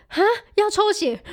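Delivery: high, loud shocked cry. Retold or imitated. Hears she must give blood. (震惊地大叫)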